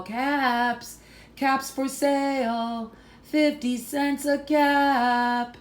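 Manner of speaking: high, slow sing-song calling out caps; peddler's sales call. Acted. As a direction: call it out like a street seller. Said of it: "and call".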